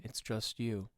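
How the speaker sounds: low, soft disembodied voice; voice of consciousness. Pretend. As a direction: murmur low as his inner voice. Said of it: "it's the voice of your consciousness".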